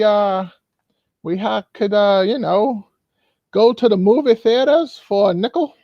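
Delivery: put-on lilting elderly voice looking back; old-timer reminiscing. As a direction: play an old man dreamily recalling the good old days. Old folks reminiscing together.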